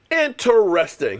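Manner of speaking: "Interesting" drawn out syllable by syllable; slow, skeptical "Interesting"; mock pondering. Real, not acted. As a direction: stretch "Interesting" slowly with sarcasm. Mock pondering, drawn-out second take.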